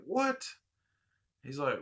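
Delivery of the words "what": a sudden high, stunned "What?"; shocked what. Retold or imitated. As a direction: blurt it out in disbelief. Stunned at the offer of a gift.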